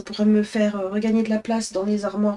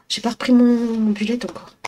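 ordinary talk, nothing marked

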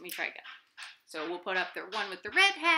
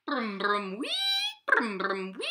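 crane noise. Acted. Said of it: "heard another sound".

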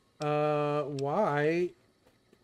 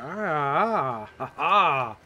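laughing; 5 dB louder, much slower than usual. Real, not acted. Chuckles at spotting a vault.

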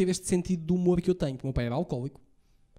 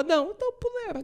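much higher put-on mum voice; mother's retort. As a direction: squeak it like an indignant mum.

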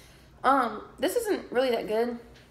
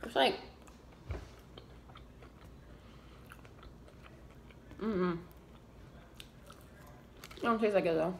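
chewing while talking; muffled by food; mouth full of fries; 3 semitones lower, 8 dB quieter than usual. Real, not acted. Seen 3: Puts fries in mouth, chews while saying "mm-mm.

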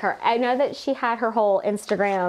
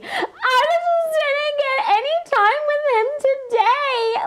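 very high, slow mock-crying whine; mock sobbing; slower than usual. Co-host imitating a sobbing contestant, mock crying whine. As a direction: blubber it out like a hammed-up crier. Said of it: "she was sobbing".

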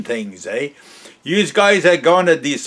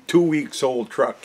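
ordinary delivery

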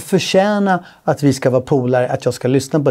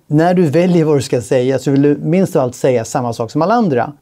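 normal talking voice

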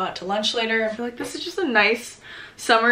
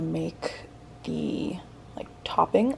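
whispering; 3 semitones lower, 9 dB quieter, much slower than usual. Real, not acted. Whispered aside while making drink.

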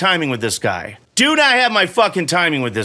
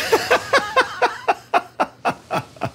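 a burst of high, breathy laughter; 4 dB quieter than usual. Real, not acted. Streamer laughing alone at a hard decision, high laugh. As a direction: laugh it off at the game's choices.

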